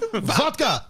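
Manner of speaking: mock yell. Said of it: "he just yelled it".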